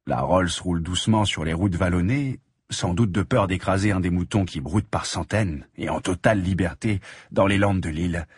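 ordinary delivery

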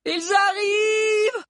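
very high, slow drawn-out scream; long high-pitched alarmed howl. A narrator's reading. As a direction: shriek a warning of attackers. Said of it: "hurla Lunella".

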